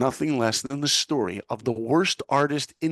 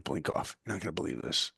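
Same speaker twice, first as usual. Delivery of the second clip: hushed teaser; 7 semitones lower, 10 dB quieter than usual. Retold or imitated. Friend on the phone hinting at the news, hushed. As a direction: lower and much softer, like a secret.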